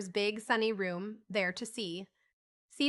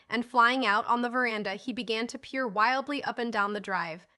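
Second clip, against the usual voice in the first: usual speaking voice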